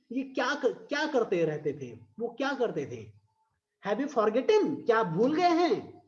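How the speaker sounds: ordinary talk, nothing marked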